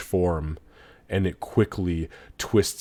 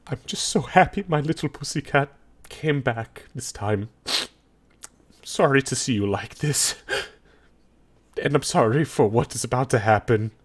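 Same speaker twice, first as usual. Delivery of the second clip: higher character voice, happy then sorry; fond, apologetic Xavier; 4 semitones higher than usual. Acted. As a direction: speak fondly to your pussycat, then apologise. Game master voices Xavier.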